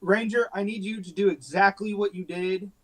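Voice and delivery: normal talking voice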